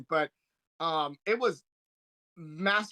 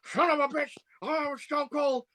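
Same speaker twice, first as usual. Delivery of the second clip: J R impression; 9 semitones higher than usual. S0 imitates the announcer losing it over Stone Cold. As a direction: do the frantic wrestling announcer. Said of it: "who's like".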